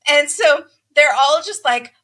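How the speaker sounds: ordinary delivery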